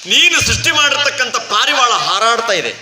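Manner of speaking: Narada's lyrical praise. Acted. Sage praising his Lord's creatures on earth, declaiming. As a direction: proclaim each wonder as a storybook sage. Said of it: "ಎಷ್ಟು ಚಂದ ಹೇಳ್ತಾನೆ ನಾರದ".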